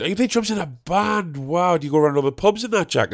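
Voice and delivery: high-pitched, over-impressed mimicry; impressed gardener. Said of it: "replaced all the mates with Jack".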